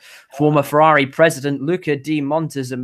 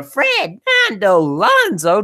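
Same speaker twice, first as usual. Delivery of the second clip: slow, higher, louder ringside-style name call; grand name announcement; 7 semitones higher, 4 dB louder, slower than usual. Acted. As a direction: announce him like a boxer entering. Grand naming of the driver.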